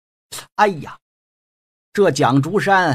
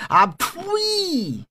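a scornful, much higher "pah!"; contemptuous spit; 12 semitones higher than usual. Acted. Thug mocking the fake doctor, scornful high spit. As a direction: spit the word out in disgust.

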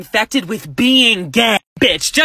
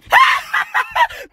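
big high shrieking laugh. Real, not acted. Laughing at the thought of a proposal, shrieking cackle.

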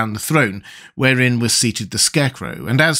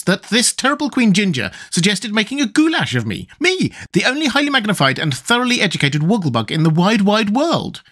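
high, self-important bug voice; high-pitched character distress; distressed Wogglebug; 9 semitones higher than usual. A narrator's reading. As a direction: protest in a high, affronted voice. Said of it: "who had a distressed look".

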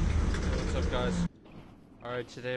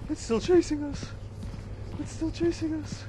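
high, loud frightened cries; scared horror game streamer; 14 semitones higher, 4 dB louder than usual. Real, not acted. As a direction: cry out while fleeing. Monster still chasing.

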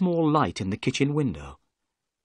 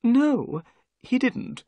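high-pitched, nervy character voice; nervous little man; 12 semitones higher, slower than usual. Acted. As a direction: play a strange, nervous little host. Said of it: "Thaddeus Sholto replied".

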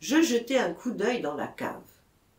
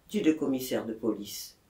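ordinary delivery, nothing marked

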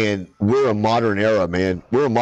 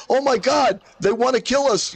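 high, squealing mock alarm; panicked alien; 13 semitones higher than usual. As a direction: shriek it like a scared alien. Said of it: "And he's going".

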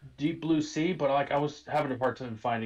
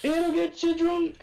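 high, slow, louder mocking voice; Dave Chappelle impression; 14 semitones higher, 4 dB louder than usual. Quoting Chappelle's sketch to cohost, high mock voice. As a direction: stretch it out in a silly high voice. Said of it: "Dave Chappelle makes fun of it".

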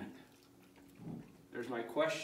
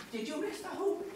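talking to a dog; 10 semitones higher than usual. Real, not acted. Teasing dog after miss.

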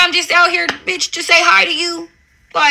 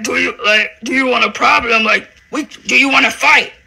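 lower, mock-timid quoting of a rival; mocking impression of her; 3 semitones lower than usual. Mocking the rival's claimed words in a meek lower voice. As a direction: mimic her asking meekly, a mocking lower voice. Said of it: "so I asked her, like".

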